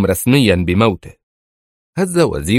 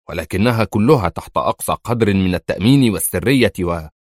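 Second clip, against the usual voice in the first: plain reading voice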